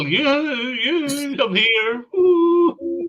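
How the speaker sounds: high, loud shouting at someone to be noticed; re-enacted loud, drawn-out call. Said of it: "I keep shouting at you".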